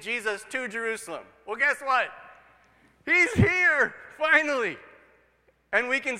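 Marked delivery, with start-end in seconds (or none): 1.5-4.8 much higher and louder, triumphant; excited. Acted. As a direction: announce it like big news. Sermon emphasis, finally arrived.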